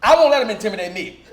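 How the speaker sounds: ordinary talk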